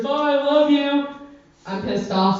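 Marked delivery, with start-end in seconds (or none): 0.0-1.1 high, slow sing-song goodbye; sweet goodbye. Retold or imitated. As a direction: stretch out a sugary farewell. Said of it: "He's like".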